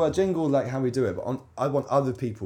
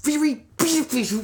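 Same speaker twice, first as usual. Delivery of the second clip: mock; 12 semitones higher than usual. Mocks a word in silly voice.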